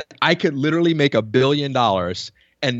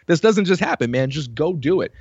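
friends urging him on; 7 semitones higher than usual. Retold or imitated. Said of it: "they were like".

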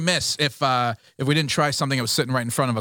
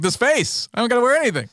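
cocky Irish local; 7 semitones higher, faster than usual. Pretend. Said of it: "I'm like".

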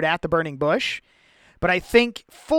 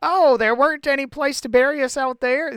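higher, mock complaining voice; whiny Israelites; 8 semitones higher than usual. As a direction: whine it like grumbling people.

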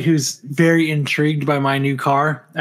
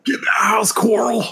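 mock Rick Grimes yell; 7 semitones higher than usual. Friend echoing the Coral bit: high mock yell. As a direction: yell it after him in a put-on accent.

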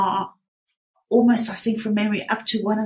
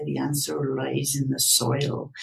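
put-on lower, Somerset-accented old comedy line; host recalling an English comedy catchphrase, in a lower Somerset accent; Somerset bloke; 7 semitones lower, 4 dB quieter than usual. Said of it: "some bloke from Somerset".

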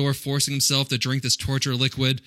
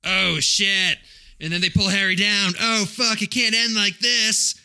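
higher, hammed-up panic in the recap, slowing on the last line; mock alarm; 6 semitones higher, slower than usual. Host retelling the cave scene with hammed-up panic. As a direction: play up the danger like a trailer.

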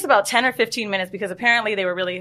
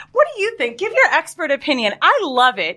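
high showy fake-enthusiastic questions; much higher performative gushing; 6 semitones higher than usual. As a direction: rub it in sweetly. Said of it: "I was like".

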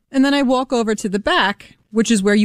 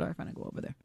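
muttered, barely voiced giving-in; a whispered, resigned all right, fine; grudging whisper; 22 dB quieter, much faster than usual. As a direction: mutter it under your breath, fed up. Grudging retold reply to poll worker.

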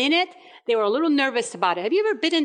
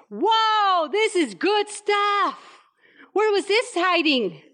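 higher, slow amazed whoa; 5 semitones higher, slower than usual. Acted. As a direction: savour it, delighted and surprised. Master tastes the wine.

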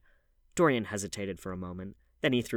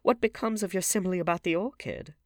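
high, loud challenging question; pitched-up indignant query; crying out; 11 semitones higher, 7 dB louder than usual. A narrator's reading. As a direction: exclaim it high and insistently. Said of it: "cried the Duchess".

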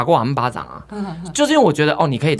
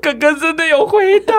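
scared sister; 13 semitones higher, 4 dB louder than usual. Retold or imitated. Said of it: "妹妹这时候已经在旁边吓哭".

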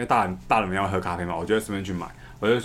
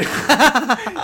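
loud laugh; 13 semitones higher, 6 dB louder than usual. Real, not acted. Laughing at own joke.

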